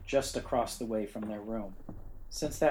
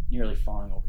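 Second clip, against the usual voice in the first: usual speaking voice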